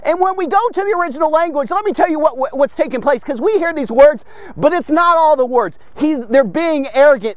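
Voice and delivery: ordinary talk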